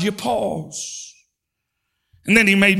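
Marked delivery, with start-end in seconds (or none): none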